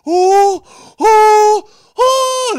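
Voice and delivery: three very high, loud "oh" hoots; shrieking falsetto ohs; funny excited whoop. Retold or imitated. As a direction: imitate a nerdy guy losing it over a rare card. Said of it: "he makes this really funny sound".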